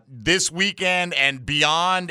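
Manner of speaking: ordinary talk, nothing marked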